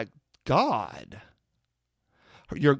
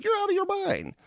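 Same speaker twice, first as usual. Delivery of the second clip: incredulous retort. Retold or imitated. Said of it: "I was like".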